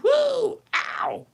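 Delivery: high, loud whoop, then a yelp; comic theme-song whoop and ow; playful whoop and yelp. Pretend. Whoops after singing the theme.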